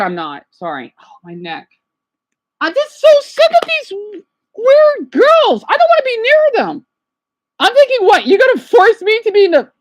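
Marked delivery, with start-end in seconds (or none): 2.6-9.7 upset outburst. Real, not acted. Vlogger venting at comments, high and loud. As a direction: push it up high in disgust. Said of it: "I'm so upset".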